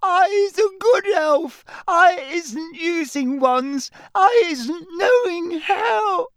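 high squeal, crying and shouting; terrified elf crying. Acted. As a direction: wail it in terror. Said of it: "her eyes rolling in terror".

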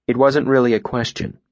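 usual speaking voice

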